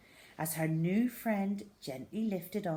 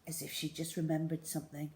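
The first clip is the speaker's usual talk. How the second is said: ordinary delivery, nothing marked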